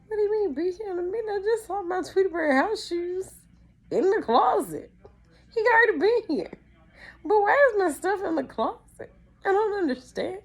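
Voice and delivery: high, loud mock crying; hysterical child. Child who saw the hidden gift, hammed-up tearful wail. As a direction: squeal in tearful protest like a little kid. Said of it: "I am just hysterical".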